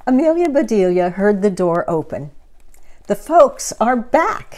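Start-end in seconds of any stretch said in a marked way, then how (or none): 3.1-4.6 excited. Acted. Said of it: "she said".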